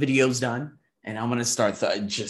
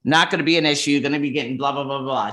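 higher, louder pleading to a friend; retold plea to friend; 4 semitones higher, 6 dB louder than usual. Asking best friend Andre for money, louder and higher. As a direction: spill it out fast to your buddy. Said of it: "I was like".

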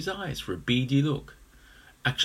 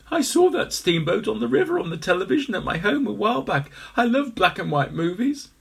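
excited egg; 9 semitones higher, 4 dB louder than usual. A narrator's reading. Henry enthuses.

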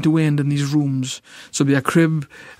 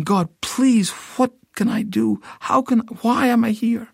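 a pleading cry to God, higher; begging God for an answer; desperate plea; 7 semitones higher than usual. Retold or imitated. Pleading prayer to God retold.

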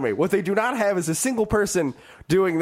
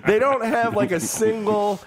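ordinary delivery

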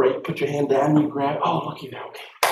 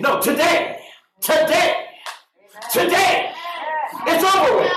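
building shout; 14 semitones higher than usual. Real, not acted. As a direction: climb higher on each today.

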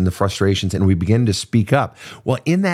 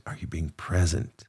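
"present" dropped much softer, almost hushed; soft repeat; 3 semitones lower, 10 dB quieter than usual. Shown as a demonstration. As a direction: let the third "Are you being present?" fall quiet. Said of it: "repeated question".

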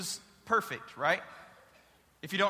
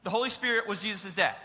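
usual speaking voice

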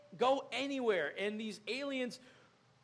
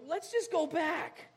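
high, drawn-out whine; mock whine to give up; whining retreat; 7 semitones higher, slower than usual. God's people facing battles, whining retreat. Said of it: "and they go".

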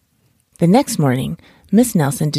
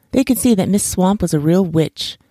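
plain reading voice

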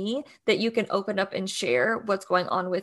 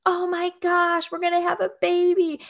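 high-pitched mock-overjoyed dad; giddy, overjoyed; 8 semitones higher, 3 dB louder than usual. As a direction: imitate a dad buzzing with adrenaline. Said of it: "over giddy and over joy".

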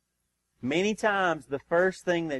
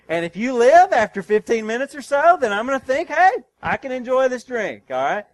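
king giving orders; 6 semitones higher, 5 dB louder than usual. Retold or imitated. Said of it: "And so he said".

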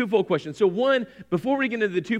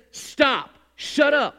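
commanding voice; 5 semitones higher, much slower than usual. Retold or imitated. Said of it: "that same voice says".

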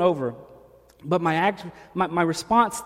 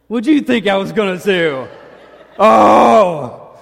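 louder, laughing jab at the crowd; laughing tease; 4 semitones higher, 11 dB louder, slower than usual. Real, not acted. Preacher teasing the congregation, laughing and louder. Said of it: "teasing the congregation".

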